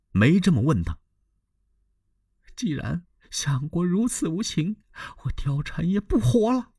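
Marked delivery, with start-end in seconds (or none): 2.6-6.7 Diaochan's desperate vow. A narrator's reading. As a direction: threaten to die, high and pleading. Diaochan threatens suicide. (貂蝉以死相逼，声音高而柔)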